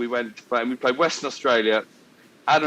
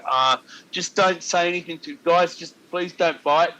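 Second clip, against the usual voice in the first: imitated pleading; 5 semitones higher than usual. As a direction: beg the lads under protest, mimicking a teammate. Teammate silently begging others not to bite.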